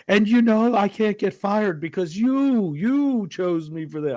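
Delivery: imitating Craig Ferguson's flirting. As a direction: ham up a boozy talk-show host egging women on. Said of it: "he's like".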